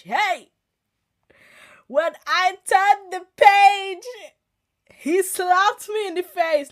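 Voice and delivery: loud, slow, high, every word stretched out; a slow, loud dramatic declaration; dramatic slow outburst. Acted. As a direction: hit it like a big reveal. Dramatic reveal of scripture hitting.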